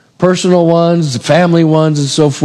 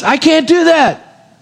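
high, drawn-out protest; emphatic refusal; 11 semitones higher, slower than usual. Acted. Joseph protesting to his master's wife, emphatic refusal. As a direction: stretch it out in high-pitched protest.

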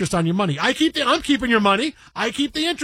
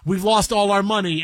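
normal talking voice

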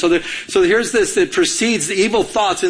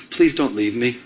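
lower, tender retold plea; loving plea; 6 semitones lower than usual. Wife-to-be answering jealousy with love, tender plea. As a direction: plead gently, imitating a loving partner. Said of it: "with love in her eyes".

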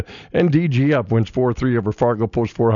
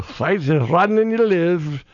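put-on terrible Scottish accent; 4 semitones higher, slower than usual. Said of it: "that's a terrible William Wallace".

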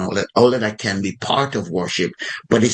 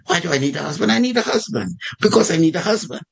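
high, exasperated mock protest; indignant echo; 8 semitones higher, faster than usual. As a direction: throw the question back, pitch climbing. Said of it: "you think it in your mind".

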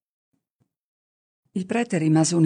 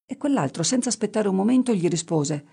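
plain reading voice

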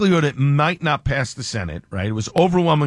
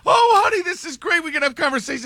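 put-on girlfriend voice; 12 semitones higher than usual. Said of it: "his girlfriend starts".

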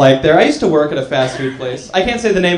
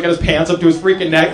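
ordinary talk

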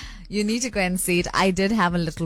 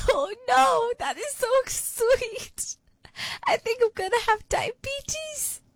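gushing, touched; 12 semitones higher than usual. Real, not acted. Presenter gushing back at the grandson's sweet message.

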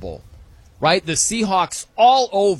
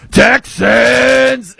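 booming, high-pitched PA announcer yell; stadium announcer shout; 8 semitones higher, 14 dB louder than usual. Retold or imitated. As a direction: imitate a stadium announcer firing up the crowd. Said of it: "the guy in the loudspeaker just goes".

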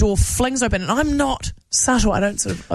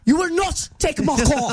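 slow, higher hammed-up threat; slow mock-fierce warning; mock defiant driver; 7 semitones higher than usual. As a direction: play a tough car owner. Acting a defiant car owner.

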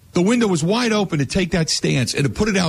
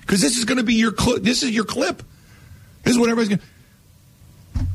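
high, pumped-up pleading; fired-up urging; 7 semitones higher than usual. Retold or imitated. Host urging the coach to take his big chance, fired up. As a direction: sell it like a pitch down the middle.

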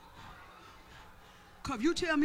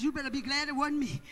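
normal talking voice

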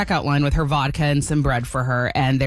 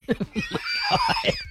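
burst of laughter; 5 dB quieter than usual. Real, not acted. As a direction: lose it laughing at the story. Laughs after telling the quote.

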